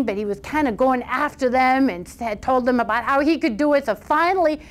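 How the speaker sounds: usual speaking voice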